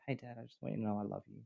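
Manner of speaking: hushed like a daughter sneaking up; quiet, tender child. Retold or imitated. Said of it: "just kind of quietly come up and just go".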